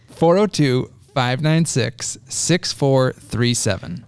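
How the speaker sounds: slow, deliberate phone-number readout; slow dictation. Shown as a demonstration. Host repeating the hotline number for callers, slow dictation. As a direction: spell out the number slowly, digit by digit.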